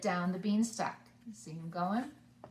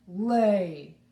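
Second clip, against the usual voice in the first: loud booming command; roar; 8 dB louder than usual. A narrator's reading. Giant roaring at the goose, loud. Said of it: "and roared".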